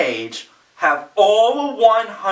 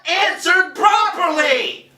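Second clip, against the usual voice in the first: angry rant; 7 semitones higher than usual. Real, not acted. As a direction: spit out each word in frustration. Ranting about pointless narration.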